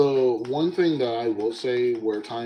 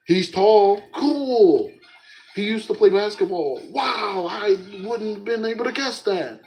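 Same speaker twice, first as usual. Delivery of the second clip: deadpan sarcasm, pitched up; sarcastic; 8 semitones higher than usual. Retold or imitated. Sarcastic replies to friend.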